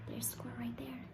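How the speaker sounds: hushed pointing aside; whisper. Real, not acted. Parent showing the child the squirrel, whispering. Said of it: "pointing at picture for child".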